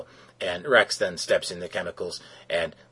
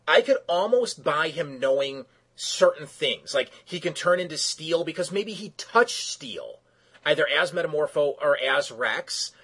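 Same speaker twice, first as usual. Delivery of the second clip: incredulous rant; 7 semitones higher than usual. Real, not acted. Ranting about the kryptonite plot hole.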